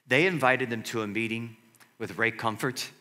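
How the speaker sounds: ordinary talk, nothing marked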